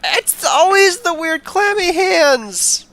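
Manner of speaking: whiny near tears. Acted. Herbert whines under questioning.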